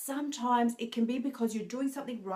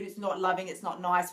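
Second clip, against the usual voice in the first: usual speaking voice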